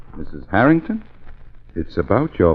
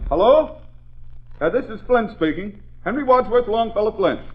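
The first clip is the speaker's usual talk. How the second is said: higher, pompous self-introduction on the phone; 10 semitones higher, 3 dB quieter than usual. Acted. Officer showing off on the phone, pompous. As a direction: announce yourself grandly down the line.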